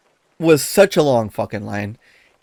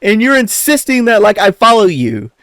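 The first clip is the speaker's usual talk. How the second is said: high, loud, exasperated telling-off; exasperated retort; 10 semitones higher, 5 dB louder than usual. Retold or imitated. To the uncle who insisted on leading, exasperated high loud rant. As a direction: vent the frustration you bit back. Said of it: "I didn't say it, but it was like".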